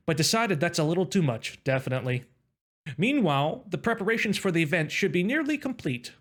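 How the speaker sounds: story narration. Acted. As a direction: read the inner monologue like a storyteller.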